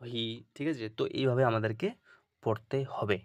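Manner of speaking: ordinary talk, nothing marked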